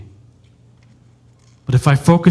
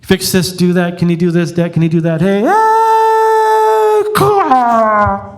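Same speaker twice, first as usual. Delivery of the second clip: put-on pestering kid; 9 semitones higher, 4 dB louder, slower than usual. Kids pestering dad.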